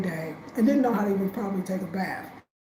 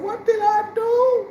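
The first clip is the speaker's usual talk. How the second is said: much higher, louder and slow; panicked; 12 semitones higher, 5 dB louder, slower than usual. Retold or imitated. Calling her sister after refusing the last plane, panicked. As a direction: wail it in sudden panic.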